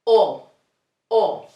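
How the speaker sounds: pure round O, no glide; Spanish O. Shown as a demonstration. Teacher modelling the Spanish vowel O. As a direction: say O short and round. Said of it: "The following one".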